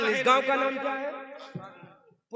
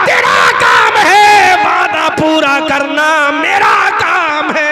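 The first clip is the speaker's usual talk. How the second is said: building to shouted climax; 5 semitones higher, 10 dB louder than usual. Acted. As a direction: build the vow into a roar. Dialogue preached, building to shout. (धीरे-धीरे गरजती हुई तक़रीर)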